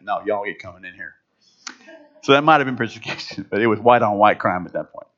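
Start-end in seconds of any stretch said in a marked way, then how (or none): 0.0-1.1 low, soft Southern refusal; Southern accent. Retold or imitated. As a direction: imitate a Southern park ranger, low and quiet. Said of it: "He was like".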